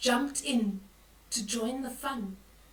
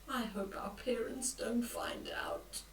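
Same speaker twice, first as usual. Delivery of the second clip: worried whisper; 7 dB quieter than usual. Acted. Boy whispering his fear about parents finding out.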